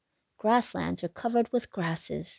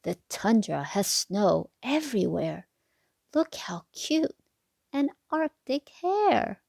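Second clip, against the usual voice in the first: put-on lilting sing-song read to little kids; bouncy storybook rhyme voice; reading to children; 4 semitones higher than usual. A narrator's reading. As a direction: read the rhyme like a bedtime picture book. Rhyming picture book read aloud.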